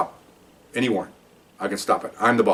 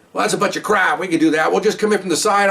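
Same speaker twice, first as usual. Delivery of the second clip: higher, scoffing pushback; officers scoffing; 9 semitones higher than usual. Retold or imitated. Officers scoffing at the new boss's order, higher and defiant.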